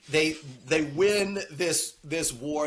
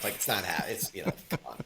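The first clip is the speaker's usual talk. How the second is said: usual speaking voice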